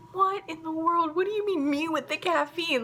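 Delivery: high-pitched, incredulous mom reaction; taken aback. Retold or imitated. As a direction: imitate being baffled by an accusation. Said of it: "Like, I didn't say this".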